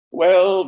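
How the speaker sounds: "Well" thrown out high and loud; emphatic well. Acted. Reader punching the refrain word to the audience.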